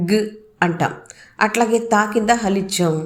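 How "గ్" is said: గ్ as a very short bare g sound; consonant without vowel. Shown as a demonstration. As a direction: clip గ్ off with no full vowel after it. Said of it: "దాన్ని ఎలా పలుకుతాం?". (గ్ ని స్వరం లేకుండా పొట్టిగా పలకడం)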